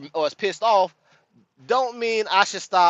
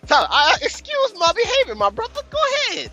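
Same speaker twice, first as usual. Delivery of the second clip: high, exaggerated courteous voice; hammed-up politeness to his co-host; mock formal politeness; 12 semitones higher than usual. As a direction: overdo the gracious apology. Mock polite yielding the floor.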